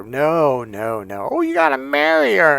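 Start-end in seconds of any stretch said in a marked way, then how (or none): 1.3-2.6 high, loud mocking pastor voice. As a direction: preach it at him in a silly high voice. Mock pastor again.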